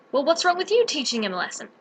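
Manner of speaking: ordinary talk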